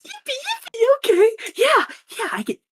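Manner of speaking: high chirpy fake-cheerful voice; sweet airhead on the phone; bubbly villain. Villain on a call stone.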